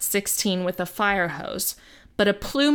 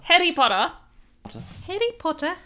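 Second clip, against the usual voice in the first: lilting Scottish-style Hetty Potter, pitched higher; mock Scottish accent; 7 semitones higher, faster than usual. Host repeats the joke in a mock Scottish accent. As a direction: play up a silly Scottish accent. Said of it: "that's my Scottish accent".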